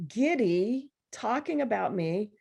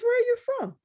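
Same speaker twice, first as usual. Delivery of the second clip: pitched way up and louder, voicing the stranger; high, loud mock voice of the man asking; guy's curious question; 6 dB louder than usual. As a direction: ask like a nosy stranger leaning in. Stranger questions her at event.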